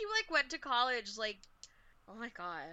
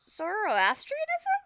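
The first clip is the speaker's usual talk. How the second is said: playful handoff; slower than usual. Real, not acted. Handing over, playful.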